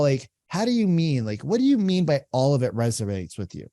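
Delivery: ordinary delivery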